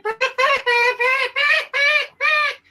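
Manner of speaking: a shrill, breathless giggle breaking out of excitement; excited high cackle. Acted. Hen giggling because she got excited for the camera. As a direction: let the laugh run high and loud. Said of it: "I'm getting all excited here".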